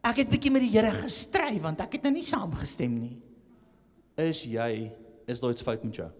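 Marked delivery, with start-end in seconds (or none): none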